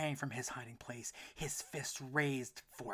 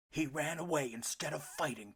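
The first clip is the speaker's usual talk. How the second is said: louder angry papa; bellowing father; 4 semitones higher, 6 dB louder than usual. A narrator's reading. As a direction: scorn the son's running. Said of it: "Papa bellowed".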